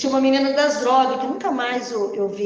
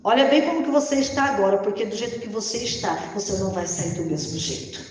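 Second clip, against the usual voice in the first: ordinary talk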